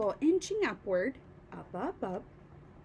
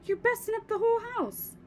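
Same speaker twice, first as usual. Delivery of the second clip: put-on anxious boy; 7 semitones higher than usual. A narrator's reading. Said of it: "George called back".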